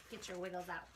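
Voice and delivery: usual speaking voice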